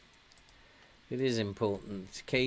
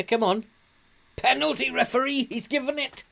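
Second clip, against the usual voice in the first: high-pitched, loud cheering for a penalty; yelling at the screen, sure it's a penalty; excited; 13 semitones higher, 6 dB louder, faster than usual. Real, not acted. Manager cheering his team at a possible penalty, excited. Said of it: "Oh, I got excited".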